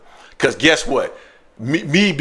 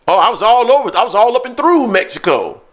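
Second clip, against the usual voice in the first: ordinary delivery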